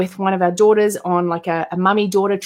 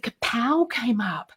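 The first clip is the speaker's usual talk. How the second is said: whisper; 7 dB quieter, slower than usual. Real, not acted. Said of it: "whispered aside".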